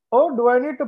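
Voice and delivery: lightly mock an Indian accent. Host joking as Sheena joins the stream, put-on Indian accent. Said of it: "put on my Indian accent".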